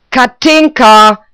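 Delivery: slow, high, drawn-out yell; loud, high scolding shout; screaming the name. A narrator's reading. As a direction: holler for the girl at full voice. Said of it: "schrie die alte Guschke".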